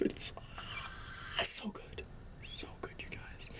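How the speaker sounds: soft unvoiced whisper, fading out; awed whisper. Real, not acted. Fan whispering to viewers how good the show is. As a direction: breathe it out in an overwhelmed whisper.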